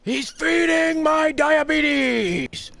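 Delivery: high, drawn-out shriek; mock outraged shout. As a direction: belt it out loud and long, hammed up. Mock outburst over sweets, shouted.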